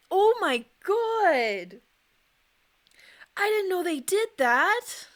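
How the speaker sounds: high, slow, stunned disbelief; shocked. Real, not acted. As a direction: gasp it out, horrified. Shocked reaction to video.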